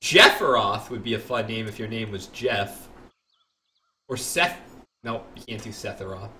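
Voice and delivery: ordinary talk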